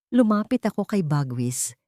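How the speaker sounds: plain reading voice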